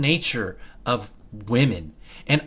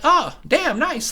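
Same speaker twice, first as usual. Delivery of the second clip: pleased surprise; 13 semitones higher than usual. Retold or imitated. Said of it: "And I'm like".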